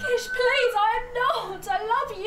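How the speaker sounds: panicked, loud begging; terrified pleading. Acted. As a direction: beg him desperately, voice rising. Begging through locked door.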